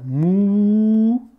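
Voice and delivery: Moussa with a long high held mou, pause, long sa; syllabes très étirées. Shown as a demonstration. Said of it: "ça s'écrit comme ça". (Moussa très étiré, syllabe par syllabe)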